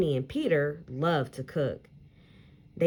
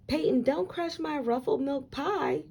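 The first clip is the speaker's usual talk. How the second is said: higher teacher voice, mock alarm; playful teacher warning; 10 semitones higher than usual. Miss Alvarez to Peyton, higher mock-alarmed warning. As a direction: warn her off the pie, teasing.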